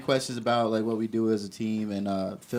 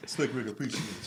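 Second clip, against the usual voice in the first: sultry narrator; 7 dB quieter than usual. Acted. Continuing the sexy voice bit.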